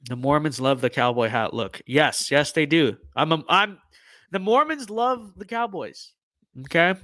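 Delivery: ordinary talk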